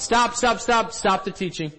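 high, loud, rapid-fire repeated stop; imitated urgent interruption. Villager halting the teaching to ask a question, imitated urgent call. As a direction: cut in urgently on the speaker.

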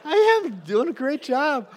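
very high, loud, boastful; giddy self-praise. Retold or imitated. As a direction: gush over your own playing. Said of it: "I'm like".

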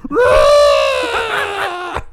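loud, high mock monster roar; Godzilla roar. Imitating Godzilla roar on street.

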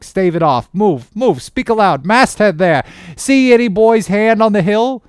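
loud, high-pitched urgent calls aloft; shouting orders. Acted. Mate calls to the masthead lookout, shouting urgent orders. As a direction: hail the lookout at full voice, pitched right up.

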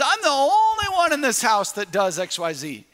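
mock a fed-up parent's grumble; complaining parent. Parent griping to the kids in a loud whine. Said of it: "Have you ever said that to your kids?".

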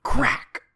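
sudden high, loud "crack"; sharp burst imitating a whip; whip crack noise. Host voicing the comic's whip sound effect, a loud crack. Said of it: "it makes the crack noise".

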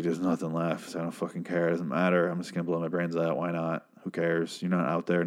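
put-on low, soft, defeated drunk; mumbled hopelessness of a drunk, lightly acted; drunk despair. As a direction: give up quietly, as if drunk and alone. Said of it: "he's drunk".

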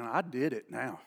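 low, hushed retold disbelief; subdued, stunned regret; guilty inner thought. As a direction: drop right down, as if thinking aloud. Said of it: "now he's thinking".

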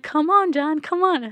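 high, loud acted-out urging; urgent pleading call. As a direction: call out to hurry someone along. Acts out Daenerys urging Jon.

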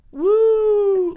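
very high, loud held whoop; wooing. Retold or imitated. Imitating the guy who kept wooing, loud whoop. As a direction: hoot it out long and loud like a club heckler. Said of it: "like that over and over again".

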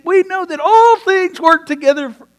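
loud, high, ringing proclamation; preaching at full force. Real, not acted. Pastor proclaims Romans eight to the congregation, loud and high. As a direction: throw the verse out to the whole room.

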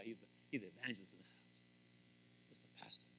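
unvoiced aside; whisper. Real, not acted. Pastor whispering a joke aside to listeners. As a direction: whisper it like a secret. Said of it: "whispered aside".

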